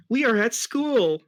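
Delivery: high, loud and slow; squeaky excited sidekick; Tails voice. Acted. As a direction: announce it like an amazed little kid. Tails voiced in read story.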